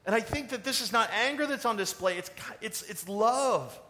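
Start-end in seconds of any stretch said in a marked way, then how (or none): none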